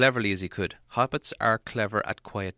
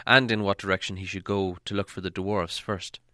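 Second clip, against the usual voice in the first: ordinary delivery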